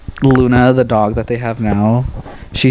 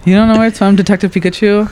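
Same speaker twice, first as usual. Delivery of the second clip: incredulous; 9 semitones higher, 3 dB louder than usual. Real, not acted. Shocked sibling doesn't know reference.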